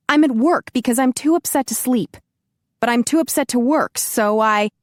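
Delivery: venting her anger down the line; wound-up complaint to him; upset. Acted.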